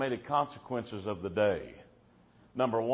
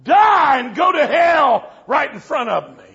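shouted; 9 semitones higher, 11 dB louder, slower than usual. Retold or imitated. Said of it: "And the preacher said".

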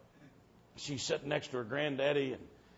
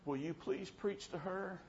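higher, softer pleading quote; mildly imitated tearful plea; 7 semitones higher, 6 dB quieter than usual. Little old lady asking the preacher to preach, mild imitated tearful plea. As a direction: lightly voice a crying old lady's plea. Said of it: "little old lady's standing there crying".

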